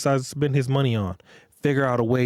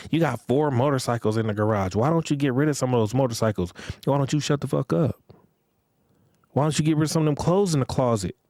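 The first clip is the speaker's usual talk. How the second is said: mock nagging; 5 semitones higher than usual. Mocking woman nagging about motorcycles.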